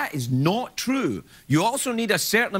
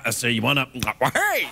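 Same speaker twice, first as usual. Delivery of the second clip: Foghorn Leghorn; 5 semitones lower than usual. Pretend. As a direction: bluster and stumble like a cartoon rooster. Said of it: "Foghorn Leghorn".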